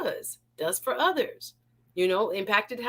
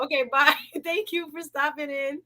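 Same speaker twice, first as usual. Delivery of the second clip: ordinary talk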